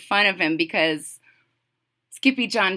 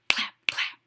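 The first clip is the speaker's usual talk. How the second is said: soft, toneless rhythmic whisper; whispered cue; 8 dB quieter than usual. Shown as a demonstration. Reader cues children to clap twice, whispered. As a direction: whisper the two beats.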